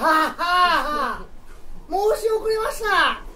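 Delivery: high, loud hammy guide; mansion guide voice. Acted. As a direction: play a theatrical mansion host. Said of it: "館の案内人ですよ".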